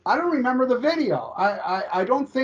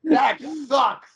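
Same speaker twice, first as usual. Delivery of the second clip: shout; 6 semitones higher than usual. Real, not acted. Friends arguing over a ballad.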